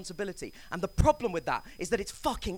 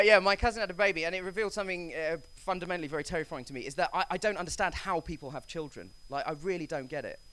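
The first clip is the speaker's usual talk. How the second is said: ordinary talk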